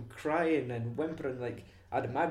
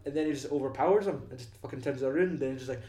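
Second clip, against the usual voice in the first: ordinary talk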